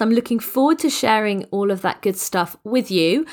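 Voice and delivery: ordinary talk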